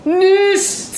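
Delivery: high urgent hiss; sharp hissed no. A narrator's reading. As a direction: stop her urgently. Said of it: "zischte Maria". (scharf zischend, hoch)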